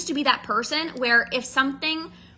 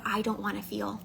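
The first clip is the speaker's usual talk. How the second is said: inner voice; 4 semitones lower, 10 dB quieter than usual. Retold or imitated. Inner voice of self-soothing.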